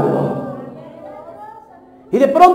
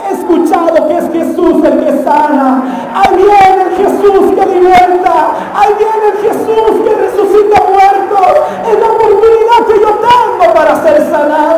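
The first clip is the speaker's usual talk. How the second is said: acted fervour, louder and higher line by line; impassioned preaching declaration; 3 semitones higher, 4 dB louder than usual. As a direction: build to a loud, high proclamation. Preacher acts out Bartimeo's rising resolve.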